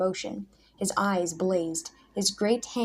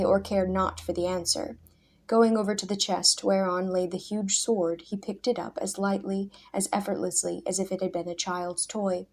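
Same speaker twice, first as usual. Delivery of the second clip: ordinary delivery, nothing marked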